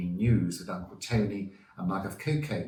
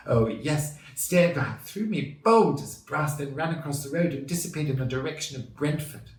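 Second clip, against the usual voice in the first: lightly voiced middle-aged lady, higher and louder; emphatic, higher voice for the local witness; worried witness; 7 semitones higher, 4 dB louder than usual. A narrator's reading. Marjorie recounts being stared at, as a worried lady. Witness answers reporter, story.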